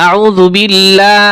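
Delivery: আউযু বিল্লাহ slowly, with a long light 'laa'; slow recitation, বিল্লাহ ending light and long; light lam. Shown as a demonstration. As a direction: draw out the thin L of বিল্লাহ. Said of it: "লা হয়েছে".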